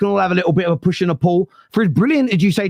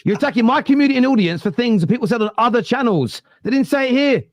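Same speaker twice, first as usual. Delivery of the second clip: higher-pitched, indignant pushback; indignant objection; 6 semitones higher than usual. Real, not acted. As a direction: defend your people, voice raised in pitch. Host objects to community being attacked.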